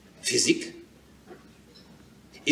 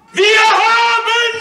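jubilant shout, very high and very loud; too loud; 11 dB louder, much slower than usual. Acted. Victory cry over a sleeping street, jubilant shout. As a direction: roar it out in triumph, stretched slow. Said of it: "Sei doch nicht so laut".

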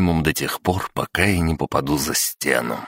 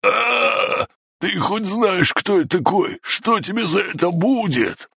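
high shrieking cry of pain; hit hard crying out; slower than usual. Acted. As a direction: scream out, hurting and threatening. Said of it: "заорал от боли".

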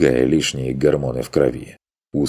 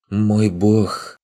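higher, languid tiny fairy voice; 6 semitones higher than usual. Acted. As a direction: coo it like a lovestruck fairy. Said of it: "томный голос фейки".

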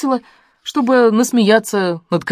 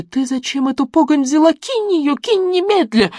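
panicked granny; 10 semitones higher than usual. A narrator's reading.

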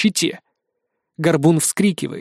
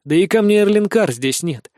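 ordinary delivery, nothing marked

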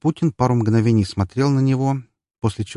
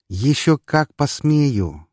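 sinister; 6 semitones higher, much slower than usual. Acted. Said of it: "зловещая улыбка".

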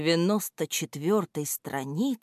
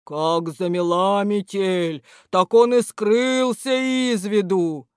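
January; 3 semitones higher, 4 dB louder, slower than usual. A narrator's reading. January answers in his voice.

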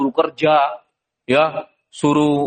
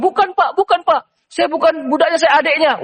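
high, urgent denial; protesting Yusuf; 11 semitones higher than usual. Acted. Young Yusuf protests to the trader, high and urgent. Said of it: "Kalau Nabi Yusuf bilang".

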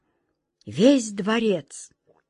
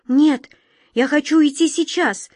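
near tears; 7 semitones higher, 5 dB louder than usual. A narrator's reading. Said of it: "чуть не плакала Глазастик".

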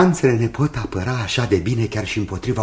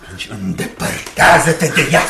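higher, louder order; menacing command; 7 semitones higher, 7 dB louder than usual. Acted. Jasper ordering Rose to leave her friend. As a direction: cut her off with a threat.

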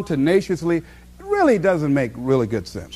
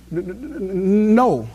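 imitating stutter; slower than usual. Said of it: "she stutters".

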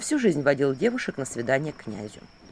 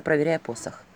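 usual speaking voice

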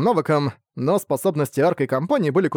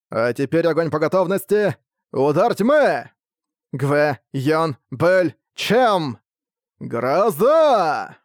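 battle cry; much slower than usual. Acted. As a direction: call out the strike names. Battle cry calling attacks.

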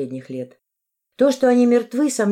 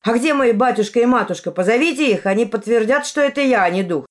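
loud, higher-pitched insistence; shouted plea; 5 semitones higher, 5 dB louder than usual. A narrator's reading. Ас asks the crowd to fetch his parents as witnesses, shouting. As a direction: plead loudly to be believed.

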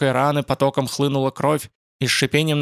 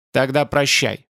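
ordinary delivery, nothing marked

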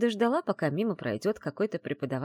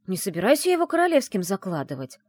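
mildly outraged, louder and higher; indignant protest; 4 semitones higher, 6 dB louder than usual. A narrator's reading. Heroine refusing to inform on him, indignant protest. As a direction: protest as if wrongly accused. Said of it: "возмутилась я".

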